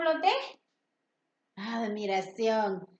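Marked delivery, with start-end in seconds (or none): none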